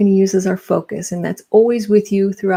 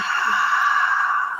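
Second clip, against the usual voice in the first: big sighing breath out; audible exhale. Shown as a demonstration. Instructor leading the second exhale, long audible sigh. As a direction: push a long, open-mouthed breath out.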